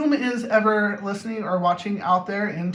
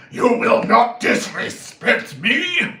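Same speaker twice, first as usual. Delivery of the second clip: slow, loud, put-on menacing voice; mock aggression; 3 semitones higher, 7 dB louder, much slower than usual. Demonstrating aggression to someone who disrespected him, hammed-up angry outburst. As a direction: hammer it out slowly, hammed-up rage. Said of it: "difference between aggression and assertiveness".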